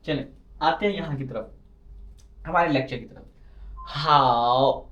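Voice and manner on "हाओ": हाओ held very long with a dipping tone; tone demo. Shown as a demonstration. Teacher models हाओ for the class, very drawn out. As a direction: stretch हाओ slowly in the third tone. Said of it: "हाओ का मतलब है good".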